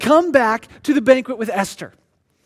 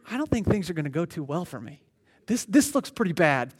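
much softer, low and quick worried mutter; nervous aside; 7 semitones lower, 10 dB quieter than usual. Acted. Haman realizes his fate at the banquet, low worried aside. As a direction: let him see the end coming under his breath.

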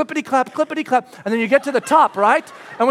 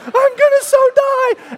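terrified yell; 9 dB louder, slower than usual. Retold or imitated. Terror before skydive.

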